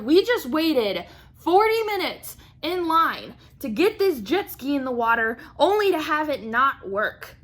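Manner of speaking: high, loud exasperated rant; hammed-up indignant protest; frustrated. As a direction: drag out the complaint with heavy emphasis. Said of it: "was frustrated on his behalf".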